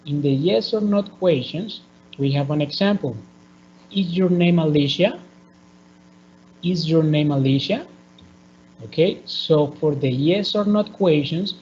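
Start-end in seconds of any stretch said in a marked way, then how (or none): 3.9-5.2 "Is your name Alicia?" with the pitch climbing sharply on "Alicia"; yes-no question, rising on the last word "Alicia"; rising question intonation. Shown as a demonstration. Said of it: "we are going to use the rising intonation". 6.6-7.9 "Is your name Alicia?" with the pitch climbing sharply on "Alicia"; yes-no question, rising on the last word "Alicia"; rising question intonation. Shown as a demonstration. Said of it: "we are going to use the rising intonation".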